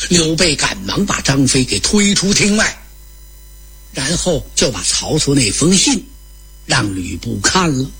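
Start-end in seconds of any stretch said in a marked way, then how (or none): none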